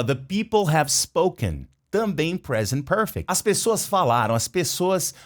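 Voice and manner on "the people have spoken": "The people have spoken" said slowly; slow, clear demo. Shown as a demonstration. Teacher repeating Graham's line slowly. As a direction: lay the phrase out for learners. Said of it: "E o Graham fala".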